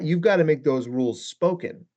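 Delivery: ordinary delivery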